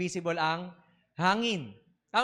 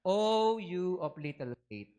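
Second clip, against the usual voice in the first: slow, gentle rebuke; slow it down like a patient teacher; slower than usual. Acted. Jesus gently rebukes Peter. (mabagal at mahinahong saway)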